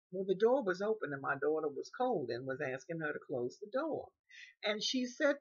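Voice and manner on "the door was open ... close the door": usual speaking voice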